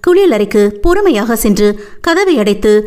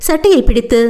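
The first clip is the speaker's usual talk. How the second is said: plain reading voice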